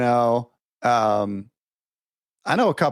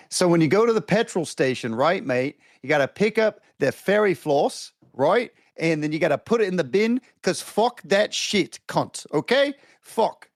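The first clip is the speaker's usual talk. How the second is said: jokey put-on Australian voice, a bit higher; mock Aussie accent; 5 semitones higher than usual. Showing off a playful fake Australian accent to the podcast hosts. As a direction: lay on the Aussie slang like a tourist doing a bit. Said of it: "I'm just gonna speak a little Aussie real quick".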